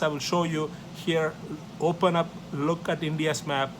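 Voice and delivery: normal talking voice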